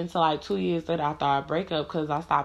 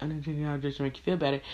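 fast, soft mocking echo of her words; mocking mimic; 4 dB quieter, much faster than usual. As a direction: parrot her back, quick and quiet. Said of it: "And he was like".

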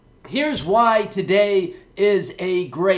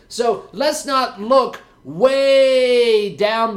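drawn out; 5 semitones higher, 4 dB louder, much slower than usual. Acted. Stressing how distant the plan is.

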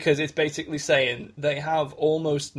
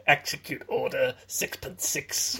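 evil emperor voice; 4 dB quieter, slower than usual. Pretend. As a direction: intone it like a villain emperor. Palpatine line parody.

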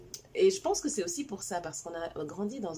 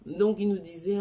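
ordinary talk, nothing marked

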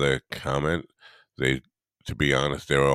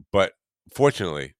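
ordinary delivery

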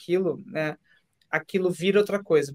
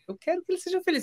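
tender wish; 12 semitones higher, 5 dB quieter, much faster than usual. Retold or imitated. Imagined kinder thought.

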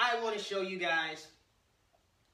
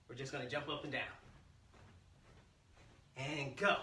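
usual speaking voice

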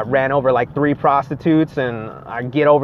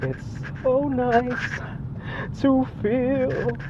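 blissful savoring; 9 semitones higher, 4 dB quieter, much slower than usual. Real, not acted. Savoring the first sip.

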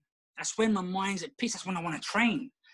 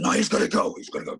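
put-on stern voice, first words breathy and louder; mock stern wife; 5 semitones lower, 5 dB louder than usual. Husband mimicking his wife ordering their son to the gym. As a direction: play the wife insisting the boy goes. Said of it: "She doesn't talk like that".

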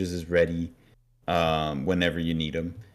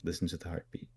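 hushed coaxing; 10 dB quieter, faster than usual. Retold or imitated. Priest coaxing Riley to listen.

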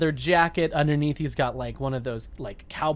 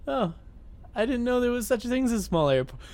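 posh voice; 7 semitones higher, faster than usual. Pretend. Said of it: "I know you're saying that in a posh voice".

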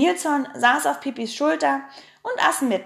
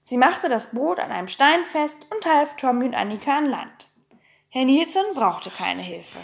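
ordinary delivery, nothing marked

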